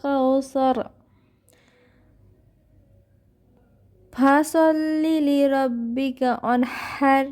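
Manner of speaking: slow, drawn-out melodic recitation; tajweed recitation; Quran recitation. Shown as a demonstration. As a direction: chant each word long and even. Recites verses.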